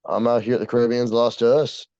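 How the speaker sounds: ordinary talk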